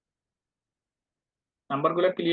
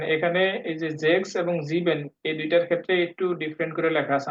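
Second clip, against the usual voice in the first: ordinary talk, nothing marked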